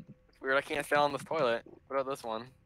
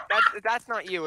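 usual speaking voice

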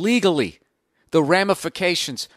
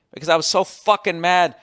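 normal talking voice

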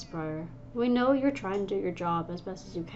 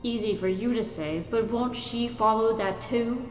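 hissed complaint; 4 semitones higher, 6 dB louder than usual. Acted. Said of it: "Firesayer hissed".

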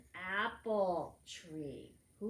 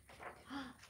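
gasp; 5 semitones higher, 10 dB quieter than usual. Acted. Surprise at butterfly.

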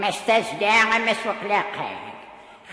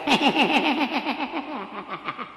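high rippling cackle that dies away; mocking cackle; 6 semitones higher than usual. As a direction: laugh scornfully at his complaint. [name] laughs at his complaint. (taḍsa n usmesxer)

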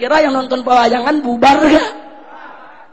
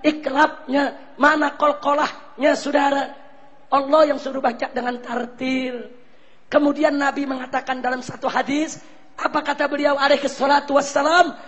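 ordinary talk, nothing marked

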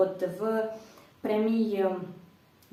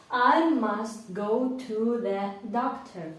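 slow model sentence; much slower than usual. Shown as a demonstration. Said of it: "проговоріть це речення вголос".

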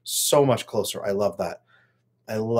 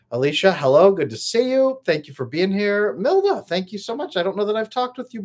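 excited greeting; 8 semitones higher, 4 dB louder than usual. Real, not acted. Greeting live chat members by name.

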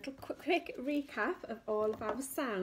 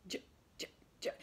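soft sound demo; 9 dB quieter, much faster than usual. Shown as a demonstration. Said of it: "Can you say that?".

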